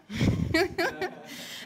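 breathy high laugh mid-quote; amused laugh. Real, not acted. Laughs while reading quote.

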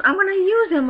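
put-on high-pitched old-lady protest. Old lady refusing to lend Xbox, protesting voice. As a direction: object in a high old woman's voice. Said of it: "this old lady comes in and goes".

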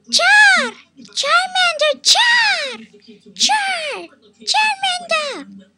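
very high, loud shrieking creature cries; Charmander voice. Pretend. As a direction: screech Char like the fire lizard. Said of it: "Charmander, next".

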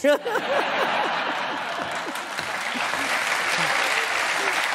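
big real laugh. Real, not acted. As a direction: burst out laughing. Host laughs at village phone line.

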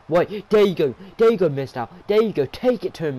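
urgent, shouted encouragement; excited ringside yelling; loud cheering on. Real, not acted. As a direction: cheer the underdog on loudly. Cheering on the underdog.

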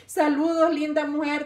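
laughing greeting. Real, not acted. Laughing while greeting viewers.

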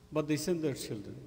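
ordinary delivery

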